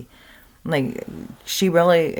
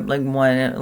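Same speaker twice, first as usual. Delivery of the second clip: usual speaking voice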